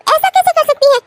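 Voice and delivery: high, fast, indignant protest; outraged shock. Acted. Daughter learns mother fixed her match, shocked and outraged. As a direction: rattle out your outrage, pitched high.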